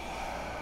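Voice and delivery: long soft exhale; soft, voiceless sigh of breath; demonstrated out-breath. Shown as a demonstration. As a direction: let the breath out audibly and gently. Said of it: "feel the fe-, whole feeling of it".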